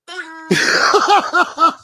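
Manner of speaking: a very high, loud cartoon boing. Cohost imitates a bounce with a loud, high boing. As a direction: pop it out like a spring.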